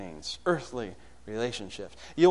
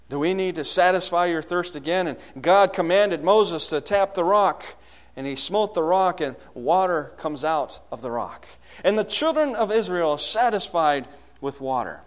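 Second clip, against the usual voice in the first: higher, louder sermon delivery; impassioned preaching; 5 semitones higher, 7 dB louder than usual. Real, not acted. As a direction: preach it out with rising force.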